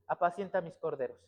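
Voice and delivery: low, soft instruction; gentle charge. Retold or imitated. Jesus entrusts his lambs to Peter, softly.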